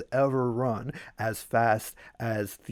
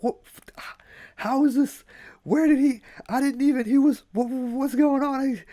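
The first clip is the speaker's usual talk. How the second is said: high-pitched breathless stammer, broken up; hammed-up gasping bewilderment, far higher; panting disbelief; 13 semitones higher, 4 dB louder than usual. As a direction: sputter it out, winded and baffled. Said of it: "super just panting out of breath again".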